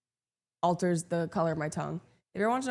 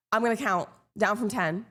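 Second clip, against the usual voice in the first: ordinary delivery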